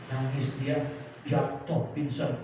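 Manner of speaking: usual speaking voice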